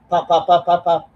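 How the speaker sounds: popping gunshot sounds with the mouth; mouth gunshot noises. Retold or imitated. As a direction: pop pop like pistol shots. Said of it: "gonna be like".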